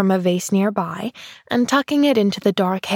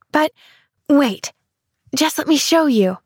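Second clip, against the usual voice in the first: higher, bursting with an idea; eager exclaiming; 6 semitones higher than usual. Acted. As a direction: call out with sudden eagerness. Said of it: "she exclaimed".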